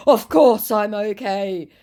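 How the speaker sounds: high, slow mock assurance; scammer's put-on voice. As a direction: drag it out as the scammer. Acts scammer's reply.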